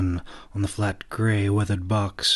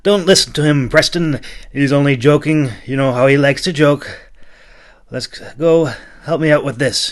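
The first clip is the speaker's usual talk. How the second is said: louder, higher brusque voice; impatient, raised knight voice; 6 semitones higher, 7 dB louder than usual. A narrator's reading. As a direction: brush off the joke and hurry them along. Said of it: "he growled impatiently".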